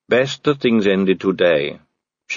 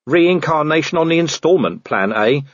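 weakly offered quip, pitched up; weak joke; 8 semitones higher than usual. A narrator's reading. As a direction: try a feeble joke in a higher voice. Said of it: "said Vimes weakly".

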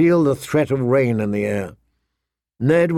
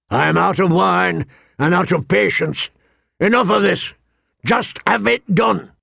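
impatient anger; 6 semitones higher than usual. Acted. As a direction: snap it, fed up. King loses patience.

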